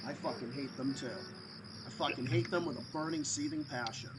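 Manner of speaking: ordinary talk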